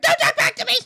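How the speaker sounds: high, very loud shout; playful yelled telling-off; mock scolding. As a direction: holler it as a hammed-up reprimand. Repeats own mock scolding shout.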